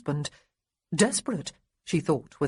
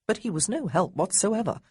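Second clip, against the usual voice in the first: ordinary delivery, nothing marked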